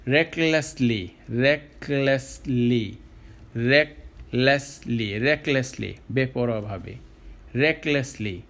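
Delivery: ordinary delivery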